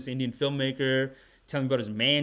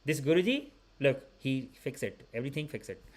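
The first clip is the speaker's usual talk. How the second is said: put-on Indian uncle accent; 2 semitones higher than usual. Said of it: "he goes".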